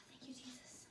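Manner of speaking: very soft, breathed thanks; whispered relief. Real, not acted. Teen mom as the baby stops crying, whispered relief.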